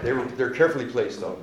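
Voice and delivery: ordinary talk, nothing marked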